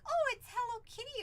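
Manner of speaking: high-pitched re-enacted delight; gushing mom. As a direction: gush over something cute, retold. Mother re-enacts delight at bat.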